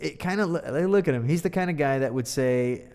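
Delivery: ordinary delivery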